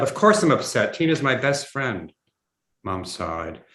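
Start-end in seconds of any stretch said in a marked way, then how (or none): 0.0-2.1 higher, louder indignant comeback; upset retort. A narrator's reading. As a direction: fire back, openly upset. Upset son snaps back at mother.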